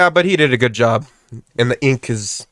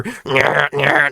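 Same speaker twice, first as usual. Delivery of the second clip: buzzy "nyah" machine noise; repeated mock buzzing sound; imitated tattoo gun. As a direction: mimic a needle droning away.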